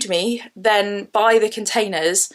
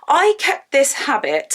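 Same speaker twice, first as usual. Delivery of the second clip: panting after running upstairs; words squeezed out between breaths; 6 semitones higher than usual. Real, not acted. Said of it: "sorry, I'm slightly out of breath".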